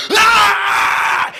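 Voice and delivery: high, loud shouted howl; full-throat scream; rock scream. Retold or imitated. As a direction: roar into the mic. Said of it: "and goes out there".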